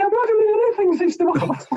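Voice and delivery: a terrified trainee's high squeak; high-pitched panicky voice. Retold or imitated. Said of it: "Very high-pitched, panicky voice".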